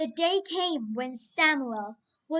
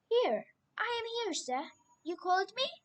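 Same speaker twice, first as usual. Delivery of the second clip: put-on young boy voice; 6 semitones higher, 5 dB quieter, much faster than usual. Samuel runs to Eli.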